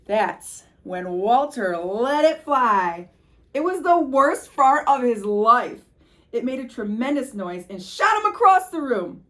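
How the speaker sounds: loud, slowing, big storytelling climax; climactic build. A narrator's reading. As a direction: belt out the big moment.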